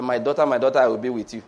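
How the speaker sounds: low, mock-solemn divine message; voice of God. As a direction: imitate God speaking, deep and measured. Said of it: "what did he say".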